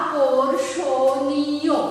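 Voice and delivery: আকর্ষণীয় very slowly, each syllable drawn out; syllable-by-syllable pronunciation. Shown as a demonstration. Teacher introducing the next word to students, syllable by syllable. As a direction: stretch every syllable of আকর্ষণীয়. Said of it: "ঠিক একইভাবে যদি".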